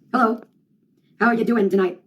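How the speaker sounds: ordinary talk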